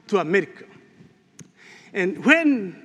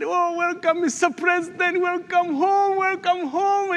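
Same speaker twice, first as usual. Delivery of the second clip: crowd cheering; 10 semitones higher than usual. Retold or imitated. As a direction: cheer the president home. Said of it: "they said".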